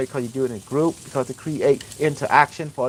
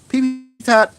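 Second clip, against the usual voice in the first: silly high voice; 9 semitones higher, 4 dB louder, slower than usual. Retold or imitated. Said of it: "You go".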